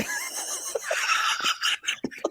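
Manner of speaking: very high breathless laughter; wheezing laugh. Real, not acted.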